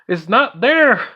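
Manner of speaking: high, loud mock wail; exasperated protest. Player insisting to imagined viewers, exasperated mock wail.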